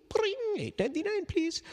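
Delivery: high, fast chirpy till voice; cashier. Acted. Reader playing the shop cashier, high and quick. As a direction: ring it up like a cheery cashier.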